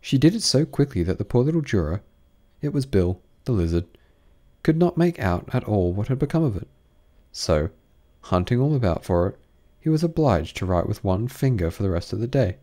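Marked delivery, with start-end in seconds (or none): none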